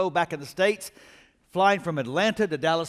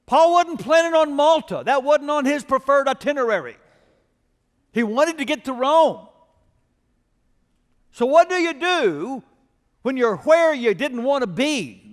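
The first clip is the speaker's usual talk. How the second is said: louder, higher, driving sermon climax; preaching at full force; 8 semitones higher, 7 dB louder than usual. Acted. Pastor rallies his congregation about Malta, preaching at full force. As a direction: rouse the congregation with a big preaching push.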